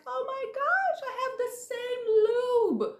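high mock gush over the lube; mocking Chelsea. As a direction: squeal it like an overexcited girlfriend. Mocking Chelsea's excited reaction.

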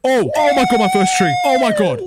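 high, loud excited outburst; overjoyed discovery. Real, not acted. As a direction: cry out high and loud, thrilled. Spots first tree.

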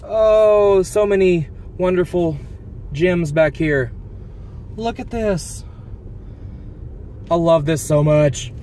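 high, loud, gushing delight; delighted gasp. Real, not acted. Driver spotting old shops, loud delighted gushing. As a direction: gush over the gems you spot.

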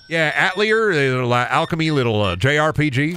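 imitated Southern farmer explaining; Southern accent. Farmer explains the game to GameStop clerk, imitated Southern accent. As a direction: answer as a hesitant Southern customer. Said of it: "the funniest Southern accent encounter".

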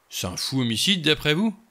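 can't believe what is being suggested; incredulous exclamation. A narrator's reading. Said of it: "s'exclama Blore, incrédule".

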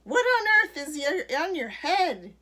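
loud, high, scandalised shout; blustering storybook shout. A narrator's reading. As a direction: shout the question in disbelief. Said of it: "She always shouted everything she had to say".

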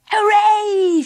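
high, loud little cheer; gleeful small-creature holler; joyful shout. A narrator's reading. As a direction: cheer with a long squealed ending. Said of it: "shouted Baby Bee".

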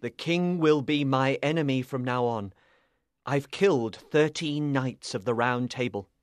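low, sombre knight; heavy, subdued sorrow; sad. Acted. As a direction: confess it sadly, voice dropped. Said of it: "he told her sadly".

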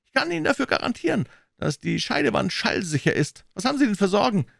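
high-pitched banker putting a client at ease; self-assured banker. Acted.

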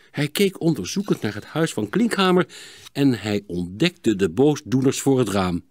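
plain reading voice

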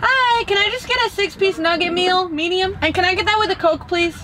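sweet and high for the drive-thru speaker; high polite order into the speaker box; customer voice. Real, not acted.

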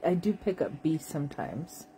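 usual speaking voice

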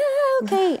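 very high, loud panicked yelp; mock nervous squeal. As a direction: give in with a hammed-up shriek of nerves. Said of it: "I was so nervous".

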